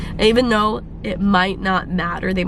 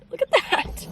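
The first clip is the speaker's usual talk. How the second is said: much higher, sing-song marvel; delighted squeal; 14 semitones higher, 5 dB quieter than usual. Real, not acted. Showing viewers the sunrise, high delighted squeal.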